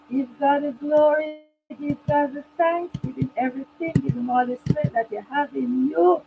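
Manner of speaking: normal talking voice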